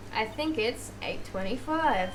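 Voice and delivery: slow, soft time announcement; odd, drawn-out clock voice; clock's announcing voice. Acted. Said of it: "a strange way to announce a time".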